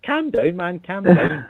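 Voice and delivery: high, loud teasing calm down; mock soothing. Teasing his edgy friend, high mock-soothing calm down.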